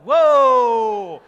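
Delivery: sudden loud, high startled whoa. Retold or imitated. Husband startled by his wife's tweezing offer, loud whoa. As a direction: recoil at the idea, shouting.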